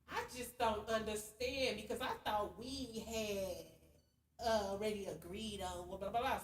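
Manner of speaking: slow, soft, prissy put-on voice; mock his fussy, sassy complaint; sassy man impression. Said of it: "he just talking to me sassy and weird".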